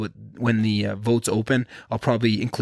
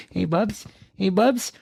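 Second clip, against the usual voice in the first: very high, cutesy pet-calling voice; 9 semitones higher, slower than usual. Real, not acted. Owner calling his puppy Bubs, high baby talk. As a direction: squeak the nickname to your puppy. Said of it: "That's how I talk to my puppy".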